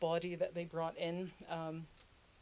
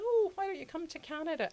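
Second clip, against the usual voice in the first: much higher, inviting sales-pitch voice; a hammed-up cheery recruiter, much higher; mock promo pitch; 10 semitones higher than usual. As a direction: sing it out like a cheery poster ad. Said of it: "we're like".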